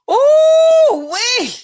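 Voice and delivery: high, loud, drawn-out ooh-wee; scandalized ooh-wee. Real, not acted. Host reacting to the affair gossip with a high, loud ooh-wee. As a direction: squeal at the juicy gossip.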